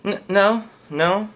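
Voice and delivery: put-on appalled high-pitched girlfriend no; girlfriend refusing. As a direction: shut him down in a girl voice. Girlfriend rejecting him.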